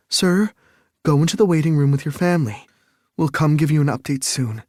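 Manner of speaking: fast, high, firm instruction; hurried, no-nonsense nurse; urgent nurse. Acted. Nurse sending him away.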